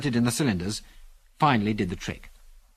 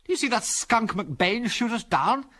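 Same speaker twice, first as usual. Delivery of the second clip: slow, higher bitter question; drawn-out, raised-pitch bitterness; 10 semitones higher, slower than usual. A narrator's reading. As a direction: dwell bitterly on each word. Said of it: "he said bitterly".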